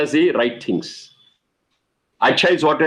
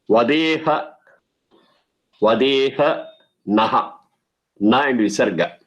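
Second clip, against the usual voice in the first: word-by-word recitation; much slower than usual. Shown as a demonstration.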